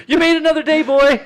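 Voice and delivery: put-on very high, loud baby-talk to a dog; cooing to the dog. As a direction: coo at the dog with relief.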